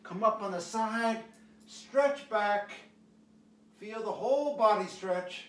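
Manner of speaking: high, loud coaching call; energetic stretch cueing. Acted. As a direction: drive the class through the stretch.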